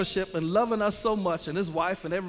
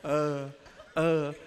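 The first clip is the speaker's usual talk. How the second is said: low, mouth-hung-open "uh"s; dumbfounded stammer; 5 semitones lower, much slower than usual. Retold or imitated. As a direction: act struck dumb, stuck on one low sound. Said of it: "my mouth was hung open".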